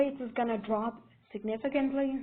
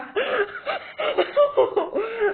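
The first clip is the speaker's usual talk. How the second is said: loud, high cackling laugh; helpless laughing fit at own joke; bursting into laughter; 11 semitones higher, 6 dB louder than usual. Real, not acted. Narrator imagining a nursery rhyme over carnage, cracking up laughing.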